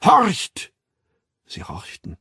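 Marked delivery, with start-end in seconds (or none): 0.0-0.7 sudden high, loud call; ringing, high-pitched order to listen; trumpeted command. A narrator's reading. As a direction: trumpet it out like a herald. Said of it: "posaunte die Stimme".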